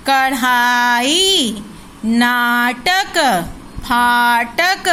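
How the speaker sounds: put-on each word stretched out slowly for children to repeat; slow drill reading. Shown as a demonstration. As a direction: read every word very slowly, syllable by syllable. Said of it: "एक बार हम इसको दोहरा लें". (बच्चों के लिए धीरे-धीरे खींचकर पढ़ना)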